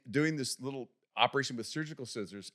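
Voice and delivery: ordinary talk, nothing marked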